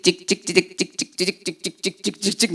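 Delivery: low, soft staccato zaps; imitated printer noise. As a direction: mimic a receipt printer under your breath. Imitates a machine printing results. (낮고 작게 출력기 찌직 소리 흉내)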